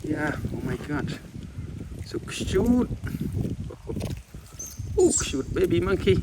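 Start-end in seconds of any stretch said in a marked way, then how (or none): 2.0-6.2 gushing coo. Real, not acted. Cooing at baby monkey.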